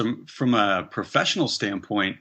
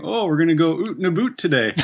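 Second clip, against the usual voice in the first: higher, laughing mock Canadian accent, oot and a boot; 7 semitones higher, 3 dB louder than usual. Mocking how Americans imitate a Canadian accent. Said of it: "do their Canadian impersonation".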